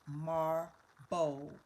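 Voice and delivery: MARBLE split into MAR, then BOWL; syllable by syllable. Shown as a demonstration. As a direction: sound out MARBLE with a gap between syllables. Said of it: "say the syllables".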